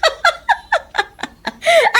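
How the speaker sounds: high staccato cackle; mocking laugh. Neighbour mocking a father over caregiving, high derisive laugh. Woman mocks Orlan's child's course.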